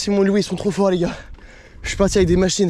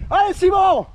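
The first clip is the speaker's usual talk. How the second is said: cheering on; 13 semitones higher, slower than usual. Real, not acted. Cheering friend up the hill.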